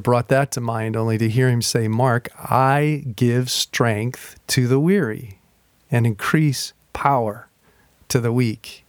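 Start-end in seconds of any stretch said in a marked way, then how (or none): 1.9-8.8 slow scripture reading. Acted. Scripture spoken as God's voice.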